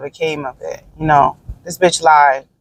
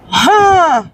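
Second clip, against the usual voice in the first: sharp, much higher "huh"; a disbelieving huff of a laugh; incredulous scoff; 11 semitones higher, 4 dB louder than usual. Real, not acted. Scoffing at the woman's long life, incredulous.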